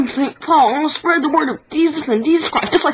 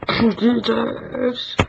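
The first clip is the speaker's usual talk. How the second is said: lower, softer, slow and solemn; Jesus voice; 6 semitones lower, 5 dB quieter, much slower than usual. Pretend. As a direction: say it slowly and gently. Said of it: "One hundred impressions".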